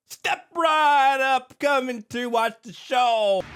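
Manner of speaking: hammed-up circus ringleader drawing a crowd; mocking the sheriff as a showman barker; mock ringmaster. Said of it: "playing the ringleader".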